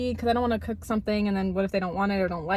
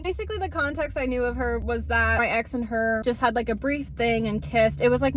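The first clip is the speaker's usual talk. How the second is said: usual speaking voice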